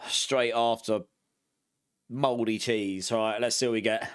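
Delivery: ordinary delivery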